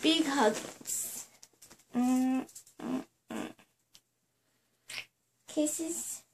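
hushed tender cooing; soft cooing. Real, not acted. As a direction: murmur lovingly into a hug. Mother hugging and kissing child.

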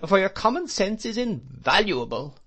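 triumphant. A narrator's reading. Aunt to Mister Dick, triumphant and high. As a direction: crow it like a victory. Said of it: "said my aunt triumphantly".

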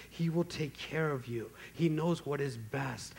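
soft, gentle voicing of the Spirit; mild whisper. Holy Spirit whispering to us, softly voiced. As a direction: lean in and speak it softly, like a near whisper. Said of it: "he whispers in our ears".

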